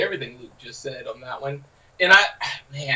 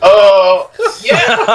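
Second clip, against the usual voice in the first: sheepish high yeah; 7 semitones higher, 13 dB louder than usual. Retold or imitated. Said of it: "I was like".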